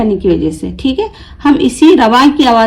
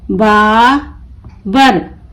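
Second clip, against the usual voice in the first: Babar very slow, long बा, pause, बर; broken into syllables; much slower than usual. Shown as a demonstration. Teacher breaking the word for learners, Babar in slow pieces. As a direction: pull Babar apart into बा and बर. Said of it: "तोड़ तोड़ कर पढ़ूंगी".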